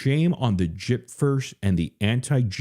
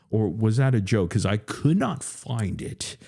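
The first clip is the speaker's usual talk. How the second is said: usual speaking voice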